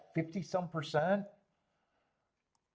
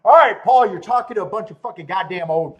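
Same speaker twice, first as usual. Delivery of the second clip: loud; 7 semitones higher, 17 dB louder than usual. Real, not acted. Shouting at chat about old people.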